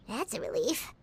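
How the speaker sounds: normal talking voice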